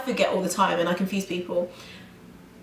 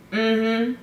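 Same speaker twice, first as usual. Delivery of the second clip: closed-mouth New Yorker "mm-hmm"; imitated mm-hmm noise. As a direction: noncommittal hummed mm-hmm. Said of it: "and they'll go".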